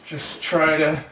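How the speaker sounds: speaking with screws in mouth; words muffled by held screws. Real, not acted. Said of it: "that's why I sound funny".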